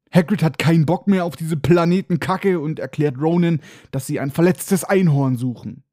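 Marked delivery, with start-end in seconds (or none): none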